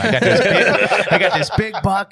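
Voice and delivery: bursting with laughter over the big buck; laughing excitedly. Real, not acted. Telling dad about the big buck, laughing and excited.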